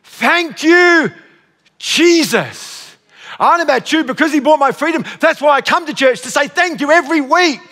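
fervent, high-pitched thanksgiving; impassioned preaching. Real, not acted. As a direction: drive the praise home to the congregation. Preaches thanks at full force.

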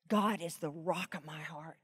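whispered-down echo of the verse; soft repeat. Shown as a demonstration. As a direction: let it sink in, quietly. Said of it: "listen, listen carefully".

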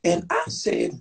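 put-on high, wailing old lady voice; old church lady. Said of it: "act like the old ladies".

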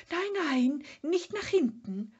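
high, loud, panicky protest; frightened. A narrator's reading. Sniff refusing to look behind, high and frightened. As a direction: yelp it out in fear. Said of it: "sagte das Schnüfferl ängstlich".

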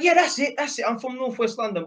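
high Cockney impression, easing down at the end; put-on high-pitched Cockney voice; Cockney accent. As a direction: imitate an excited Cockney bloke. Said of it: "that's how I'm doing a Cockney accent".

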